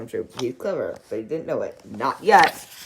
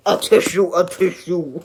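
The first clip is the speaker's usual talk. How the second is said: words sneezed out loud; sneezing; 2 semitones higher, 6 dB louder than usual. Acted. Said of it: "Sneezed the pig".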